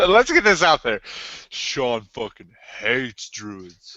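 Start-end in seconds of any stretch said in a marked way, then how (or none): 1.5-4.0 slow, deliberate declaration; slow announcement. Acted. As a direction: spell it out slowly. Mock announcement.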